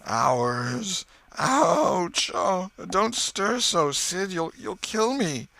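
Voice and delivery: high, slow mock agony; milk the pain, slowly; theatrical dying groans; hammed-up pain. Tom fakes dying pain.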